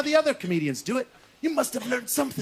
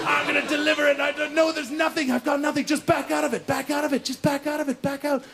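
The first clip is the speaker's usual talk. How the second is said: high, monotone stream of nerves; anxious high-pitched babble; panicked dithering; 4 semitones higher than usual. Acted. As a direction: talk yourself out of it, pitched up. Panicked inner dithering acted out.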